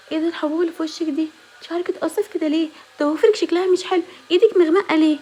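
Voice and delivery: high, increasingly loud nitpicking questions; mocking critic. Vlogger mimicking people criticizing a woman's appearance, high and loud. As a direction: mimic the snide critic of her looks. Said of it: "وما تهتميش بانتقادات الناس".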